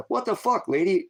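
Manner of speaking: high, stunned what the fuck; shocked outburst. Retold or imitated. Told she knows where his stuff is, high shocked outburst. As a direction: recoil in disbelief at her.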